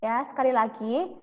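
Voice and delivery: ordinary delivery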